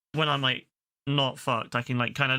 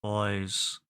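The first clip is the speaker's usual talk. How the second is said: lower, dropped-voice narrator greeting; Marshall narrator impression; 5 semitones lower, much slower than usual. Streamer imitating the narrator Marshall, lower voice. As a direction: drop into the chest like a kids' show host. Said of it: "exactly like Marshall".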